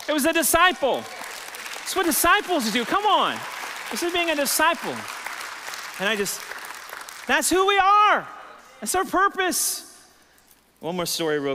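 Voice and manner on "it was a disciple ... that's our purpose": high, loud preacher's climax; full-force preaching. Acted. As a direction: build it up and proclaim.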